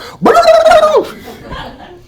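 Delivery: very high, loud mock dog barking; imitated dog barking. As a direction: burst into a frantic dog-bark imitation. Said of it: "And the dog goes".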